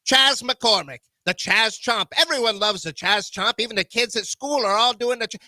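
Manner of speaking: put-on old-time radio announcer; 1930s announcer voice. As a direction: call the lineup like a 1930s broadcast. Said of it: "I'm gonna announce it in my nineteen thirties voice".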